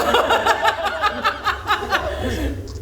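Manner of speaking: a burst of high, loud laughter. Real, not acted. Telling the crowd about the injury, she bursts into high laughter.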